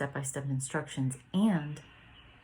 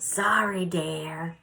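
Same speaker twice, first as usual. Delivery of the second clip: called out sorry; 2 semitones higher, 5 dB louder, much slower than usual. Retold or imitated. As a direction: call it across the yard, kindly. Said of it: "he always yells out".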